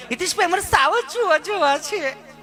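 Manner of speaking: mock the over-educated tourist lady; prim educated lady. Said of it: "ચીપી ચીપીને બોલે, બહુ ભણી ગયેલા". (ચીપી ચીપીને બોલતી ભણેલી બેન)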